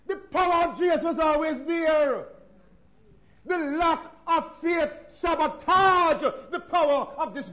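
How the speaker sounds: loud, high, slow sermon cry; full force preaching. Acted. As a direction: hammer the lack of faith home.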